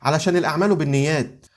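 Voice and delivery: usual speaking voice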